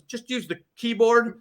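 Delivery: lightly voiced worried factory boss; imitating the manufacturer. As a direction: gently point him to the keyboard. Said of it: "he goes".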